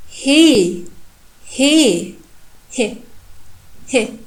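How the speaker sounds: he, moving from long to short vowel; vowel length demo. Shown as a demonstration. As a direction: stretch he, then clip it short. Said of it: "You see?".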